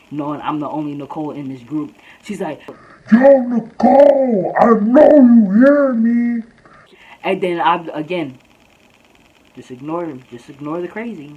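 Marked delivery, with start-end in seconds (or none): 3.1-6.4 slow, high, loud calling; imitated screaming. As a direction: holler across the courts, mimicking an angry pursuer. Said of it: "she starts screaming out my name".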